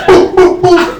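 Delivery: high loud boop gunshot noises; mock gunshot sounds; imitated gunshots. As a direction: make toy-gun noises.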